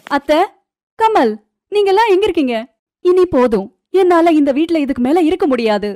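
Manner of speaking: high, loud outburst; shouting. A narrator's reading. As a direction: shout for the whole household, fed up. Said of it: "கத்தி சத்தமா கூச்சல் போட்டு". (உச்சக் குரலில் சத்தமான கூச்சல்)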